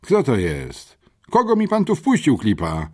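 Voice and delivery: caught-off-guard policeman roaring; surprised thunder. A narrator's reading. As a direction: boom out in surprise. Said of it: "zagrzmiał zaskoczony aspirant".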